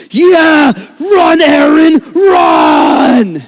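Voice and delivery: high, loud shouted cheering; dad yelling from the sidelines. Retold or imitated. Angry dad shouting at his son from the sidelines, full shout. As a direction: bellow it like an overexcited baseball dad. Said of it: "He'd be going".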